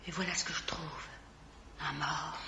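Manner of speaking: soufflé dans un murmure, horrifiée; murmurez-le, glacée devant le corps; chuchoté. Acted. Discovering the dead lodger. (chuchotement effrayé)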